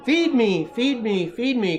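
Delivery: high, loud begging; hungry baby duck crying out. A narrator's reading. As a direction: demand food like a hungry duckling. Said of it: "cried the hungry baby duck".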